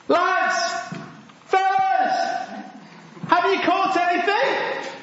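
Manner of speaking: high, drawn-out call to men far away; mock distant shout. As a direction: call out as if across a football pitch. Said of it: "He shouts again".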